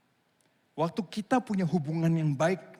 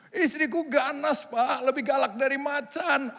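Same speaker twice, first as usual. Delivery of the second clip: whining husband; 9 semitones higher, 4 dB louder than usual. Pretend. Husband complains wife is fierce.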